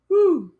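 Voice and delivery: loud high-pitched whoo; delighted high whoo; high whoop. Real, not acted. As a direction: let out an excited whoop. Reacting to the commercial men.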